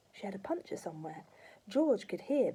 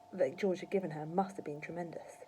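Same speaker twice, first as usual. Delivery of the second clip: plain reading voice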